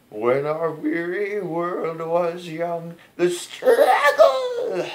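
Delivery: slow straining falsetto, breaking into a laugh; old man voice. Retold or imitated. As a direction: croak the high line like a worn-out singer. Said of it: "why am I doing my old man voice?".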